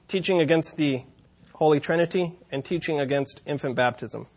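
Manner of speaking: ordinary talk, nothing marked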